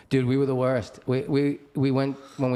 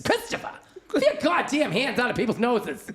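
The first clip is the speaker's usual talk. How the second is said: high-pitched imitation of scolding mom; scolding mother; 10 semitones higher than usual. Mother catching son picking her nose, scolding. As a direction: screech it up high as an outraged mother.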